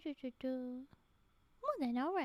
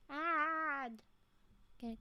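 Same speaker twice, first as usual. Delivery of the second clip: normal talking voice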